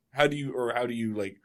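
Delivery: usual speaking voice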